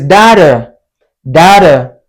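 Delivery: DATA with the T softened, like "da-der"; American soft T. Shown as a demonstration. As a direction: tap a soft D inside "data". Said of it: "like a soft D".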